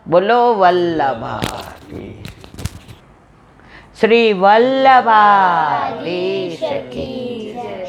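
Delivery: very slow, drawn-out chant of the call; devotional jai call. Real, not acted. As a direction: lead the crowd, stretching every word.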